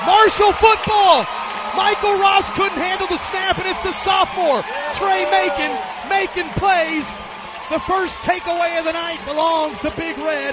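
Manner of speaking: high, loud fumble call; excited shout. Real, not acted. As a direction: yell it like a big turnover. Fumble recovered, call erupts.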